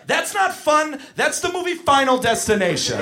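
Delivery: high-pitched, outraged scolding; exasperated shouting. Retold or imitated. Counselor telling kids this is deadly, exasperated yelling. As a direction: protest in exasperated alarm.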